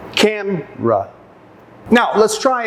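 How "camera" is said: Camera slowly split as CAM, ruh; stress on first syllable. Shown as a demonstration. Teacher marking stress on the first syllable, slow two-part Camera. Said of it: "has the stress on the first syllable".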